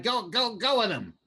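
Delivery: high, urgent orders; goblin hurrying others. Acted. Goblin hurries underlings along.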